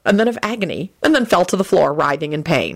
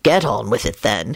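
low, weary reply; tired resignation; 7 semitones lower than usual. A narrator's reading. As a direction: say it resignedly, pitch dropped. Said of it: "he replied wearily".

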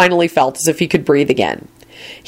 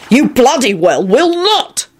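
angry, slow retort; 8 semitones higher, slower than usual. A narrator's reading.

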